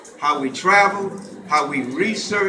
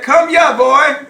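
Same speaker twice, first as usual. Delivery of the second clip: put-on "Come here, boy" shouted high with stress on "here"; Gullah Geechee shout; 8 semitones higher, 8 dB louder, slower than usual. Shown as a demonstration. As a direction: lead the crowd in a loud Gullah Geechee call. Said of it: "Can y'all say that?".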